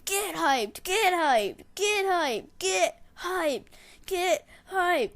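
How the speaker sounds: repeated high, loud hype chant; retold screaming celebration. Player running onto the court after first win, screaming. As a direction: yell it high, over and over, in triumph. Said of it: "You know, I'm screaming".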